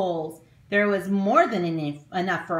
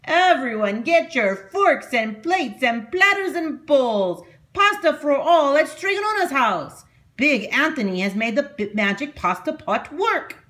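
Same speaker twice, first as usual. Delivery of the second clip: shouting; 8 semitones higher, 6 dB louder than usual. A narrator's reading. Big Anthony shouts from the fountain to the townspeople. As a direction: call out to the whole town. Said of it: "and shouted".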